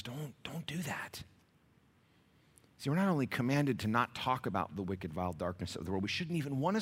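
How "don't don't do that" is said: slow unvoiced whisper; urgent whisper. Shown as a demonstration. Preacher quoting Paul to church, slow urgent whisper. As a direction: hush the warning close. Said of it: "Paul says".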